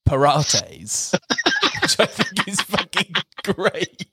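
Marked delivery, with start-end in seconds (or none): 0.1-1.1 "Pilates" meaning pirates, "puh-RAH-tayz". Shown as a demonstration. Host demoing the swap, pirates said like Pilates. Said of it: "pronouncing it like Pilates".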